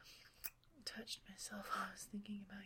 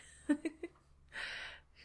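higher, louder breathy laugh; breathy giggle; 7 semitones higher, 7 dB louder than usual. Acted. Speaker amused by own joke, breathy giggle. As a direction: giggle at your own remark.

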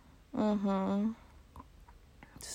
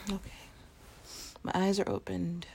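usual speaking voice

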